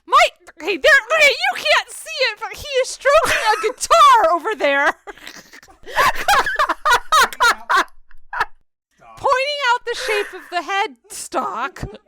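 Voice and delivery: high, loud, breaking into laughter; laughing outburst. Real, not acted. Host laughing as Mike strokes a guitar. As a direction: crack up at what Mike is doing.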